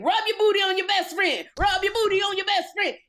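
high-pitched party MC yell; loud, chanting crowd hype; hype-man shout. Retold or imitated. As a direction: holler it to a packed club. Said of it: "He's like".

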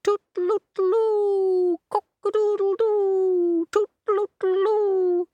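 loud, high, long-held toots and crows; high sustained rooster fanfare; trumpeting cockerel. A narrator's reading. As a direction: crow like a trumpet at full blast. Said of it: "play the trumpet as loud as you like".

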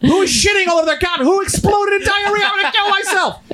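very high, hysterical mock screaming; enraged parent outburst. Shown as a demonstration. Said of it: "you will be talking like this".

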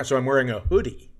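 usual speaking voice

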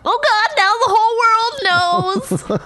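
high, loud gushing celebrity; hammed-up shallow celebrity; dumb celebrity. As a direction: ham up a dumb gushing star. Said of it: "dumb fucking celebrity will say".

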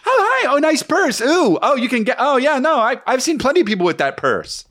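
high, sugary fake-friendly girl voice; catty girl impression. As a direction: play the two-faced girl complimenting a purse. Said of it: "The chick is like".

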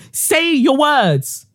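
put-on slow, high drawn-out scold like to a toddler; mock scolding. Scolding Mary as if she were a toddler, slow and high. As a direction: stretch it out like talking down to a child.

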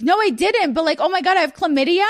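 high-pitched panicked denial, imitated; mock a boyfriend in a panic; freaking out. Boyfriend denying he cheated on her, mock freak-out. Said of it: "Why are you freaking out".

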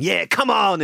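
high-pitched celebratory yell; over-the-top hyped cheer; mock triumphant scream. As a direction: shout it like a lad celebrating a win. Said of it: "you probably screamed".

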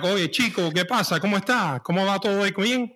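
quick, lively Cuban Spanish chatter. Retold or imitated. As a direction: rattle it off like a Cuban from Havana.

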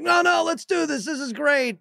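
high-pitched, insistent retold pep talk; pleading enthusiasm. Dad talking his oldest into Atari, insistent. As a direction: push the reluctant kid, voice jumping up high. Dad urging unimpressed kid to play.

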